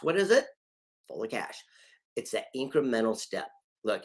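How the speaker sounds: ordinary talk, nothing marked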